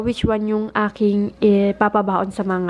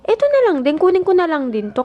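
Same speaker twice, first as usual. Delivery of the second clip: much higher, settling toward usual pitch; acted self-quote; 9 semitones higher than usual. Vlogger quoting her shopping decision to viewers, high-pitched re-enactment. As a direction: re-enact a sudden idea in a raised voice. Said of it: "Sabi ko".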